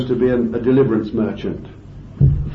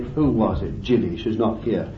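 ordinary delivery

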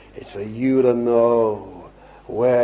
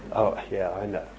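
ordinary delivery

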